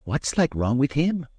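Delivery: maid's voice. Acted. Maid asks about sick lad.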